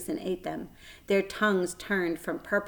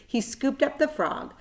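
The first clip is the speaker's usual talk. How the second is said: ordinary delivery, nothing marked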